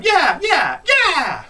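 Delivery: high, loud cheer; kids cheering. Retold or imitated.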